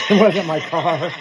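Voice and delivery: repeats the line high through laughter; giggling echo of the punchline; laughing. Real, not acted.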